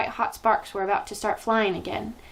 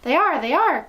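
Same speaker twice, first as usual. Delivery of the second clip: eager witch chorus, pitched up; chorused agreement; 7 semitones higher, 5 dB louder than usual. A narrator's reading. Witches chorusing back to their leader. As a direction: echo her like a fawning crowd. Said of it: "chorused the English witches".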